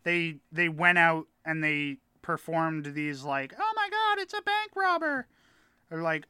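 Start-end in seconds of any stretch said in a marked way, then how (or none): 3.6-5.3 much higher, far-off hammed-up panic cry; mock panicked townsperson. Staged raid for train passengers, much higher hammed-up panic. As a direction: play a hysterical frontier townsfolk.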